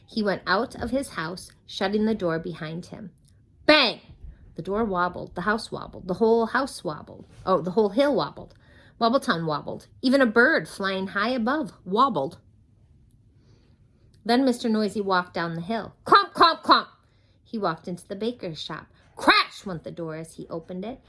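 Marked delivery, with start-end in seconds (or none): 3.7-4.0 loud bang. A narrator's reading. Mister Noisy slams his front door, loud bang. 16.1-16.9 loud high clomps; stomping clomps. A narrator's reading. Mister Noisy stomps down the hill, loud clomps.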